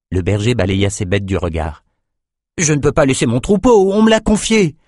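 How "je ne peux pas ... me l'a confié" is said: put-on young shepherd. A narrator's reading. Shepherd refusing Nathan's order.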